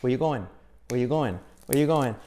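"Where you going?" as one word, "weiyugoen"; reduced linked drill. Shown as a demonstration. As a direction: lead a repeat-after-me drill of "Where you going?", R dropped. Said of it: "A ver conmigo".

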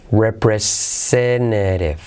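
"representative" drawn out, T softened to a tap; slow soft-R T. Shown as a demonstration. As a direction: stretch "representative", dropping the hard Ts. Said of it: "las T's las hacemos como R suave".

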